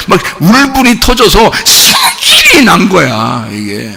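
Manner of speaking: drawn-out shout that bursts upward on 승질; exploding rage. Shown as a demonstration. Preacher shows how furious the brother was, exploding rage. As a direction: let the anger erupt, stretching the key word. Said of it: "승질이 막 그냥 승질 나는 게 아니".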